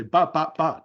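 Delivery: quick, even ba ba ba tapping the beat; syllables like three quick finger taps; imitated rhythm ba ba ba. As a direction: tap out the rhythm with your voice. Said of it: "give an actual onomatopoeia of the rhythm".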